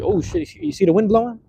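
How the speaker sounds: high surprised cry; startled. Real, not acted. Wind shakes the phone while filming, a startled high cry. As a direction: caught off guard, call it out.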